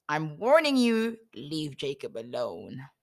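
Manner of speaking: a divine warning, drawn out and heavy; solemn God voice warning Laban; booming voice of God. Acted. God warns Laban in a dream.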